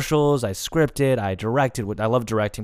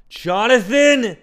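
drawn-out, high-pitched yell of a name; loud, stretched summons; angry father calling out; 12 semitones higher, 7 dB louder, slower than usual. Retold or imitated. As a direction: imitate an enraged parent summoning a child. Father calling him in, retold.

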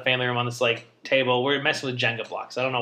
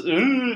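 high, tight "ooh"; tight-faced wince; 10 semitones higher than usual. Retold or imitated. Dad realising his mistake on the stairs, tight-faced wince. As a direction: squeeze it out like someone caught in a blunder. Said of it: "just tightens his face".

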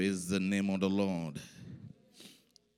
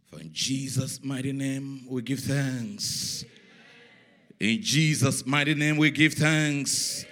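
slow, swelling thanksgiving, growing louder; raised, ringing prayer; rising prayer close; 5 semitones higher, 6 dB louder, slower than usual. Real, not acted. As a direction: lift the prayer into a loud, drawn-out finish.

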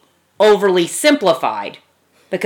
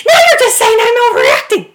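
very high, loud mock outrage; exaggerated high-pitched protest; mock overreacting; 5 dB louder than usual. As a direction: shriek it as a hammed-up upset wife. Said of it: "you're feeling a certain way, you might say".

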